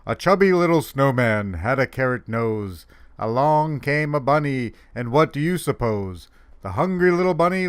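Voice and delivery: lilting storybook rhyme, dropping at the end; comic poem recital. Acted. Santa reading a bunny poem, in an animated recital. Reads a funny poem aloud.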